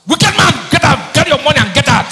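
high, loud shout throwing him out; wife exploding. Acted. Fed-up wife throwing her husband out, shouting high and loud.

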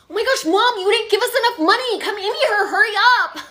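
high, loud whining shout for mom; frantic teen yelling. Acted. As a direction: holler out to the car, hammed-up. Teen yells for mom to pay.

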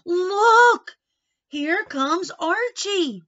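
loud, high shout of discovery; excited shout. A narrator's reading. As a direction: shout it out across the crowd. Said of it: "someone shouted".

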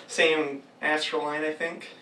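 ordinary delivery

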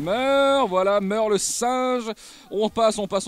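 stretched-out victory yell at the dying monster; gamer's kill cry. Real, not acted. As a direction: shout the kill at the monster, drawing it out. Finishing off a monster in-game.

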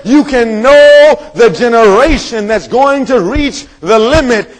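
high, slowed, driving preacher's delivery; forceful preaching. Real, not acted. Preacher explaining the signs of nearness, forceful preaching. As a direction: build question and answer from the pulpit.

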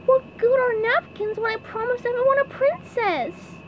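very high cartoon fretting; SpongeBob voice. A narrator's reading. SpongeBob thinking aloud about useless napkins, high fretting. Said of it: "he thought aloud".

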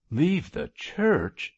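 high, slow, dismayed echo. A narrator's reading. Chaplain told by bishop to leave, dismayed. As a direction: echo it in shocked disbelief, drawn out and high. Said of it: "echoed Cargrim in dismay".

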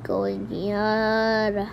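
normal talking voice

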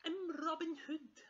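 high, loud, slow proclamation; excited shout. Acted. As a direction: announce it proudly in a cub's shout. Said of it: "he shouted".

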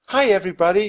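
cheerful hello to wounded soldiers. A narrator's reading. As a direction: greet the room brightly. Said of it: "she said cheerfully".

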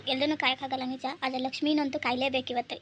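normal talking voice

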